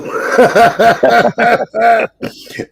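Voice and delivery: a long high cackle of laughter; gleeful high laugh. Real, not acted. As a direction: laugh it off, amused at himself. Laughs at dodging police.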